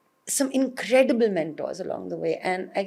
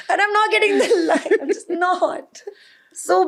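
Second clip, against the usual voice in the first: flustered laugh; 10 semitones higher than usual. Retold or imitated. Reliving a failed take.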